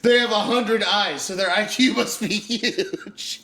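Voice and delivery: high, loud, laughing ridicule; mocking. Ridiculing co-host's hundred-eyes logic, high mocking voice. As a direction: parody a silly argument, stretching out the end.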